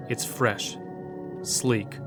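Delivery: plain reading voice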